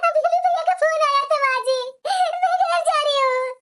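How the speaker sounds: crying, slow and broken by sobs; grief-stricken weeping, drawn out; tearful. Acted. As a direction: sob out terrible news. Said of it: "तुम इस तरह से रोने गाने लगेगी".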